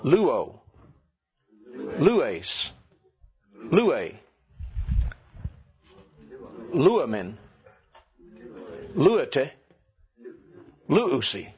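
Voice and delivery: each word from Luo to luusi punched on LU; stress on first syllable. Shown as a demonstration. Teacher drilling the class again, first-syllable stress from Luo to luusi. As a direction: hit LU hard in Luo, lues, lue, luamen, luete, luusi. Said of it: "Let's try it again".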